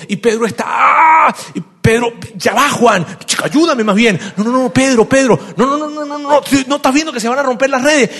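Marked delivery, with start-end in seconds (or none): 0.7-1.3 loud, high effort scream; straining yell. Acted. Pedro straining at the nets. 2.3-4.2 high, loud and impatient; harried shouting. Acted. Pedro straining, brushing Juan off. 4.3-8.1 high, loud, agitated outburst; frantic protest. Acted. Frantic exchange over breaking nets.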